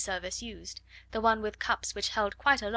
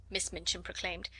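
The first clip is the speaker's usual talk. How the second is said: ordinary delivery, nothing marked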